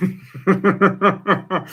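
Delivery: high giggling laugh; burst of laughter. Real, not acted. Laughing at posh voice idea.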